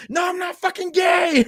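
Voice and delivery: high, loud outraged shout; super pissed. Acted. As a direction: explode in hammed-up indignation. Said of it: "He gets super pissed".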